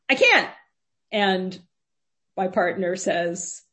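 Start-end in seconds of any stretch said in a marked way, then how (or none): 0.1-0.5 high, loud panicky yelp. Retold or imitated. Retelling a teenager's reply to police from the shower, panicky voice. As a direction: imitate a startled kid calling out in panic. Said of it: "this sort of panicky voice says".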